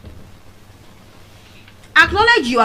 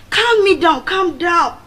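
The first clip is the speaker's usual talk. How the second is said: high coaxing calm me down; imitating people soothing her; mock soothing; 8 semitones higher, 5 dB louder, slower than usual. Boys soothing the angry speaker, high coaxing. Retelling being calmed after fight.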